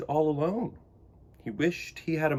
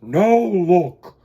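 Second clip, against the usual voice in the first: loud grumpy bark; grumpy walrus; 4 semitones higher, 12 dB louder than usual. Acted. Missus Walrus grumping at Choco, loud. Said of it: "grumped Missus Walrus".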